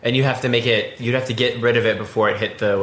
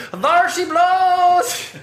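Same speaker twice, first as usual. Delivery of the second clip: very high, slow, loud comic shout; Clouseau impression; 4 dB louder, much slower than usual. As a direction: belt it like a bumbling inspector. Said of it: "and he goes".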